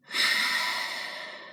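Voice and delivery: long, audible breathy exhale; big releasing sigh to demonstrate relaxing; letting-out sigh. Shown as a demonstration. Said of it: "whenever we sigh the breath out".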